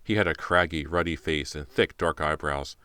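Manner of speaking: plain reading voice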